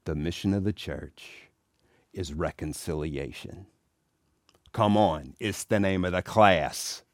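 low, hushed hint from a priest; leaning in quietly. Retold or imitated. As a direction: lean in and let them in on it. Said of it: "he leaned in and he went".

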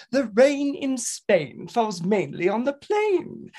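"rain", "Spain", "plain" high, slow, with pure long A; careful refined elocution, lingering on "rain in Spain"; correctly pronounced. Acted. Pupil finally getting "rain in Spain" right, precise and slow. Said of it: "correctly pronounces".

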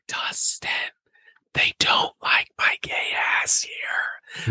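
slow whispered plea to Dustin; mock drunk whisper. Retold drunk plea to friend.

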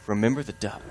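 low, hushed warning in his ear; sly sister muttering blackmail to her brother; hushed threat. Acted. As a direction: lean in and whisper the threat softly. Sister's whispered threat again.

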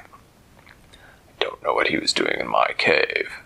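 put-on deep, slow old dragon voice; low rumbling dragon, unhurried. A narrator's reading. Dragon Hermes speaks.

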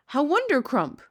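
high, loud burst of delight; beaming cry. A narrator's reading. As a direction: exclaim it with a beam. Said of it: "cried the B F G, still beaming".

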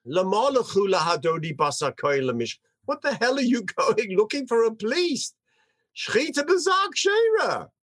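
high, quick, cheeky scoff; cheeky child retort. Acted. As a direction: scoff like a smart-aleck kid. Young Shmuel mocking the search.

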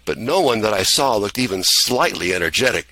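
ordinary delivery, nothing marked

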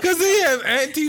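laughing disbelief. Real, not acted. Repeating a weak punchline, laughing.

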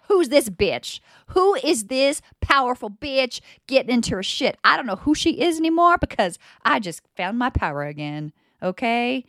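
cocky, loud self-celebration; fired-up self-hype. Retold or imitated. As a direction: crow about your own power, loud and proud. Retold self-talk after dancing.

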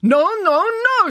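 high, loud indignant protest; emphatic, theatrical objection; outraged refusal. Acted. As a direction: refuse flatly as a flustered director. Director refusing the request.

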